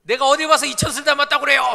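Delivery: high, loud indignant protest; acted-out offended shouting; angry outburst. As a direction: yell it like someone deeply insulted. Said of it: "막 이러면서 막 화를 내는 거예요".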